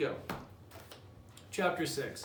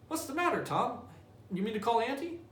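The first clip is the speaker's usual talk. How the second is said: put-on much higher, anxious boy's voice; worried little brother; 8 semitones higher than usual. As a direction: pitch it up high and fret over him. Sid worried about groaning Tom.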